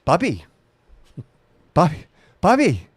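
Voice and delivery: high, loud, nagging mom voice; terrible mom impression. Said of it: "That's a terrible accent of my mom".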